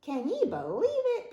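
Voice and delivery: very high-pitched pirate moan; exasperated moan. A narrator's reading. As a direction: moan in disbelief to your parrot. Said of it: "Pete moaned to his parrot".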